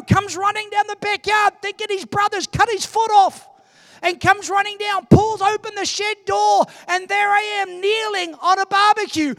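high, loud pulpit storytelling; impassioned preacher at full pitch; full-force preaching. Real, not acted. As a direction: shout the story out to the congregation.

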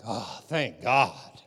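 slow, drawn-out sigh of relief; slow, relieved oh, thank God. Shown as a demonstration. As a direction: let it out like a weight just lifted. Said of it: "you could say it like".

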